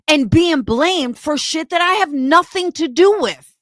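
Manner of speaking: indignant, high-pitched angry rant; real anger. Real, not acted. Furious rant about being blamed.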